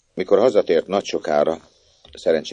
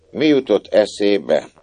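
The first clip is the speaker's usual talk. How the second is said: slow, louder „jutott” stressed; emphatic question; 5 semitones higher, 5 dB louder, slower than usual. A narrator's reading. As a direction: lean on „jutott” slowly. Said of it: "ki tudja, mi jutott eszébe". (hangsúlyos „jutott”)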